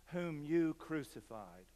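ordinary talk, nothing marked

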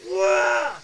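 high, loud protesting cry; frantic yell. Retold or imitated. Pulling his pillow back from the ghost, loud protest. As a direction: whine like a kid losing his pillow. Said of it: "I'm like".

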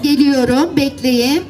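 ordinary delivery, nothing marked

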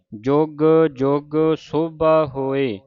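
very slow syllable by syllable recital; slow scripture recital. Shown as a demonstration. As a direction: recite it slowly for learners. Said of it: "ਉਚਾਰਨ ਸਿੱਖਣਾ ਹੈ".

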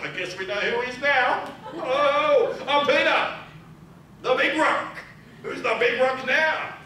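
high, loud boastful braggart; cocky Peter. Acted. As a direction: crow like a cocky show-off. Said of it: "Peter's getting a bit confident".

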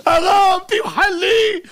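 high, loud cry for forgiveness; acted wailing plea. Rabbi begging the sage he wronged for pardon, wailing plea.

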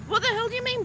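high-pitched, incredulous question; mock exasperation. Vlogger answering gibberish instructions, mock exasperation.

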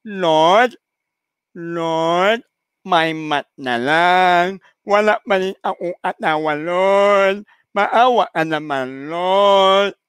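nasal, slurred prayer with drawn-out vowels; nasal pleading woman. Acted. Lonely woman praying before the statue, nasal and pleading. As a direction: beg the Lord through a blocked nose, slowly. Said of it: "Kawawa naman yung babaeng yun".

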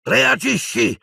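high, urgent shout; yelled challenge. A narrator's reading. Harry goading Piton to fight back, yelling. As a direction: yell it as a dare. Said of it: "gli urlò Harry".

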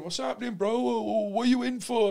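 high-pitched, excited mock chatter; imitating nosy inmates. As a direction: mimic inmates piling in with questions, pitched right up. Inmates crowding in with questions.

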